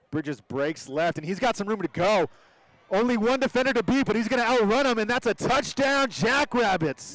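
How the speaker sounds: building from calm to a big shout; excited touchdown call. Real, not acted. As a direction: rise to a roaring touchdown call.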